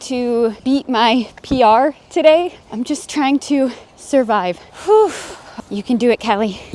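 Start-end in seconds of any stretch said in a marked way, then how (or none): 4.6-5.1 breathy high-pitched whew; high exhausted whew. Real, not acted. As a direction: blow out the effort. Tired exhale mid-run.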